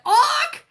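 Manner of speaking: high, loud squawk; bird squawk. Pretend. Squawk cutting into own line.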